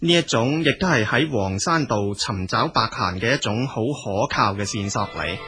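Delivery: ordinary delivery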